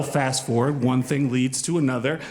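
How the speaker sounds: usual speaking voice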